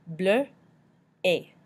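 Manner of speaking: Bleu, then A, as two separate sounds; both sounds in order. Shown as a demonstration. Teacher breaks down a harder word, separated Bleu and A. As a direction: split it: rounded Bleu, pause, "ay". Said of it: "say both of those sounds in order".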